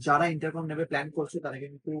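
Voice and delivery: normal talking voice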